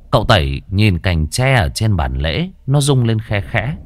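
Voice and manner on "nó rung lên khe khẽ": plain reading voice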